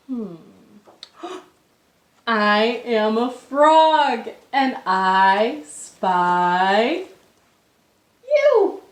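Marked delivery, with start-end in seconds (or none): 2.3-8.8 spring the surprise on the listener; playful reveal. Acted. Playful frog reveal to kids.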